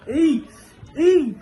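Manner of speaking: sharp startled ay; startled cry. Real, not acted. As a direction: yelp in surprise. Startled cries, slice slipping.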